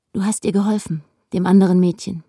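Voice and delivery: ordinary delivery, nothing marked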